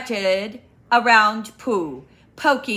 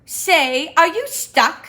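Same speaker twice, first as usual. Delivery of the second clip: Owl voice; 8 semitones higher, 7 dB louder than usual. Acted.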